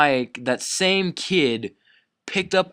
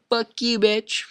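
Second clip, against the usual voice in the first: retold insult; 9 semitones higher, slower than usual. Kid thrown out of the cafeteria, high mock yell. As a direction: fling it back in a high voice. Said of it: "I was like".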